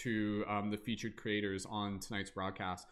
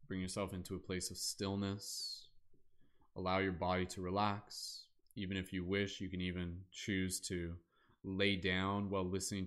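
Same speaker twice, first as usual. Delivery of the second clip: guided meditation. Acted. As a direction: ease them into stillness, unhurried. Leads closing guided meditation.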